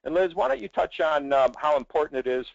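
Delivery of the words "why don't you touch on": ordinary delivery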